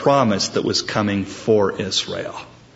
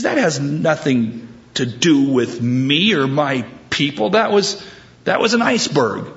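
mock indignation, climbing higher; indignant protest; 4 semitones higher than usual. Punched man disowns the blame in a joke, indignant. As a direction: argue back, pitch rising toward the end.

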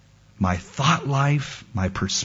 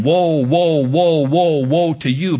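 mock biblical prophet; 3 semitones higher, 4 dB louder than usual. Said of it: "like Isaiah, no longer saying".

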